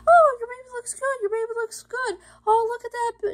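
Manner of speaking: high-pitched imitation of a reassuring technician; ultrasound technician. Technician showing mom-to-be her ultrasound, high-pitched reassurance. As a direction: voice the technician cooing over the scan, high-pitched.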